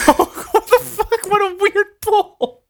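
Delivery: high-pitched, helpless laughter through the words; laughing disbelief. Real, not acted. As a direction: squeal it out while cracking up. Cracks up at obscure reference.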